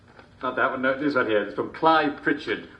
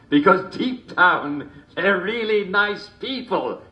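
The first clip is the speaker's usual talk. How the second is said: drawn-out punchline; 8 semitones higher, 4 dB louder, slower than usual. Acted.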